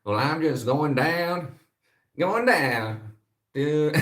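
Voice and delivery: high, slow, drawn-out Dallas drawl; Dallas accent attempt. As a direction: try on a stretched-out Texan accent. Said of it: "how do they talk in Dallas".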